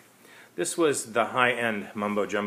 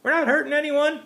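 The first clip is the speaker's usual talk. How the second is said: put-on defiant kid; 8 dB louder than usual. A narrator's reading. Kid talks back to Mary.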